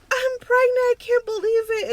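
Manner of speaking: high, loud, teary amazement; telling her boyfriend the news through tears; overwhelmed disbelief. Retold or imitated. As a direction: blurt it out in shock.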